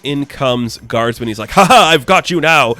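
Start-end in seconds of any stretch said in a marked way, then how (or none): 1.5-2.7 loud, cartoonish villain gloat; hammy bad-guy taunt, higher and louder. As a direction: crow it like a cheesy henchman.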